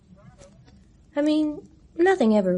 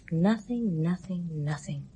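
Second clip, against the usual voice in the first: each nothing softer, trailing into near silence; fading repetition; 3 semitones lower, 6 dB quieter than usual. Acted. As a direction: let the word die away. Despairing repetition fading again.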